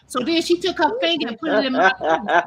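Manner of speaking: ordinary delivery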